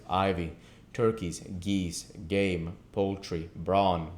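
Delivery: ordinary delivery, nothing marked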